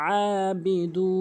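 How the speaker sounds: আবিদু with the long vowel stretched very slowly; long vowel recitation. Shown as a demonstration. Teacher stretching a long vowel for students. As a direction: draw out আবিদু, holding the vowel. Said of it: "এক আলিফ টেনে পড়তে হয়".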